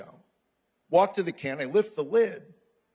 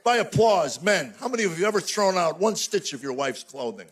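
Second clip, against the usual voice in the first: ordinary delivery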